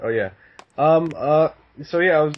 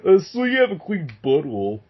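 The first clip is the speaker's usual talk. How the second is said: silly version; 4 semitones higher than usual. Shown as a demonstration. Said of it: "It would sound dumb".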